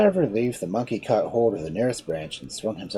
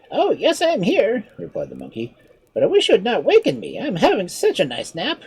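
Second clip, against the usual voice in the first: higher, lighter voice for the sly monkey; clever monkey; 6 dB louder than usual. Acted. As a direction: play the monkey, a touch mocking. Monkey answers.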